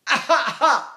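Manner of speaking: high, short "ah" wails; baby cry. Shown as a demonstration. Teacher shows breath on tummy, high baby cries. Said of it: "put the breath on your tummy".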